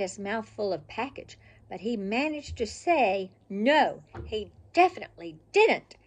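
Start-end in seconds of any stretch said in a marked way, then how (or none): 3.5-6.0 loud, punched "No" and "didn't"; firm storyteller's no; emphatic refusal. A narrator's reading. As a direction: land hard on "No" and "didn't". Scotty's emphatic refusal, narrated.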